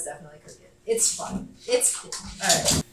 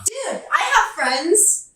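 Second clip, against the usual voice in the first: mock offended; 11 semitones higher, 7 dB louder, much slower than usual. Real, not acted.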